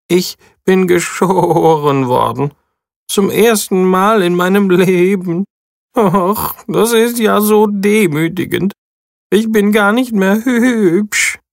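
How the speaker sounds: high, whiny lament of a sheep; high self-pitying whine; whining. A narrator's reading. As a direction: whimper it like a vain sheep. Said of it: "jammert Stanley".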